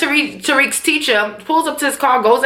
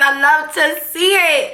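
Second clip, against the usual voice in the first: slow, higher-pitched gush; relishing delight; 4 semitones higher, slower than usual. Real, not acted. Fan savoring her favorite scene, relishing. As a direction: stretch every word out with relish. Gushing, out of the whisper.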